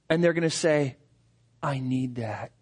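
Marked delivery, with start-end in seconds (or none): 1.6-2.5 much softer, much lower, near breaking; a broken, quiet plea; hushed and tearful. Acted. Person in tears telling the preacher, hushed and moved. Seeker in tears asks for faith.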